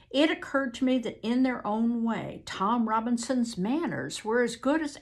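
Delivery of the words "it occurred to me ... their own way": plain reading voice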